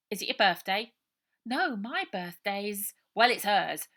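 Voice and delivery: put-on high, loud boy yelling get off; an irritated kid shoving someone away; cross young boy. A narrator's reading. Robert and Brian exchange.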